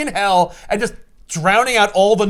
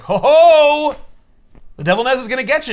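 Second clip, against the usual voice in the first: lightly voiced devil, louder; mock possessed taunt; 7 semitones higher, 6 dB louder than usual. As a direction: gloat like a cartoon demon.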